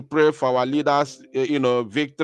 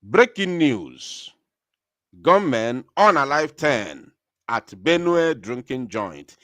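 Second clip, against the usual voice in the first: breaking news announcement; 4 dB louder than usual. Acted. Host breaking news of gunmen to listeners, dramatic announcement. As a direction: announce it loudly, stretching the headline words.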